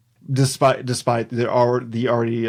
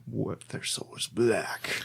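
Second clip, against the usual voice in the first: slow, much softer voice; hushed ominous musing; 11 dB quieter, slower than usual. Real, not acted. Host wondering about the creatures' souls, hushed and ominous.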